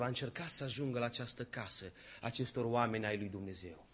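ordinary talk, nothing marked

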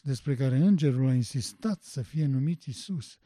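normal talking voice

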